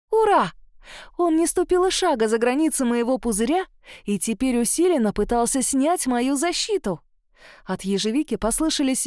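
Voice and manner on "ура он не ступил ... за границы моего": exultant. A narrator's reading. Heroine realises the doctor cannot, exultant. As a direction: cheer it out suddenly.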